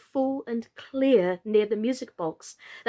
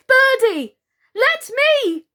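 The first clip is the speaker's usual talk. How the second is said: very high, loud scream; 13 semitones higher, 8 dB louder than usual. Acted. Totty screaming to stop Birdie, desperate. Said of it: "screamed Totty".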